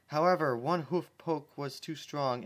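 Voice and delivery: usual speaking voice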